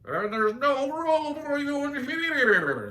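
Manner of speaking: high, slow, gurgling underwater voice; watery goldfish voice. Acted. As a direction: speak as if through water. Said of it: "I'm making a water sound".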